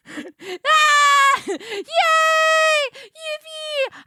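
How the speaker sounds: loud, high cries of glee; joyful cheers. Real, not acted. Streamer cheering loudly as the reveal plays. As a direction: squeal with delight.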